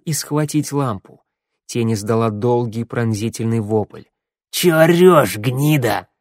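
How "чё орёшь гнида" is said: loud, raised gruff creature shout; angry bark. A narrator's reading. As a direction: bark the insult. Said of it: "рявкнул Косой".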